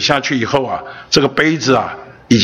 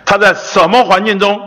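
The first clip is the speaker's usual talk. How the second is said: fervent preaching; 4 semitones higher than usual. Acted. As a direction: preach it with rising fire. Preacher builds to fervent climax.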